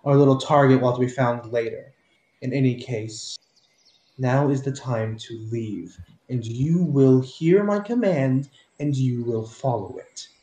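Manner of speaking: commanding woman. Acted. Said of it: "you hear the female voice go".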